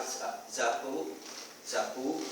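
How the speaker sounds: zapu with a z for the s, slow; zapu, zapu, childlike and soft; child's version. Shown as a demonstration. (zapu com z, como a criança)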